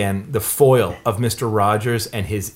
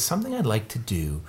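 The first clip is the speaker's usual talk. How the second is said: gently imitated unhurried request; Mister Rogers; 2 semitones lower, 7 dB quieter than usual. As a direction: quietly ease someone into a pause. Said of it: "And Mister Rogers says".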